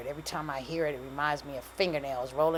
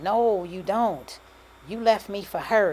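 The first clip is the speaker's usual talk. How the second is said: louder, hurt objection; a touch of wounded anger, pushed louder; hurt protest; 5 semitones higher, 7 dB louder, slower than usual. A narrator's reading. Pregnant woman telling her ex he left her, hurt protest.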